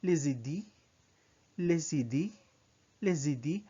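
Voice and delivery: les idées with the S linked into idées; French liaison. Shown as a demonstration. Teacher drilling French plural for learners, linked pronunciation. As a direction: join les and idées like one word. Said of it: "you say".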